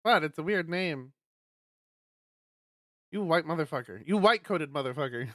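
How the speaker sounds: normal talking voice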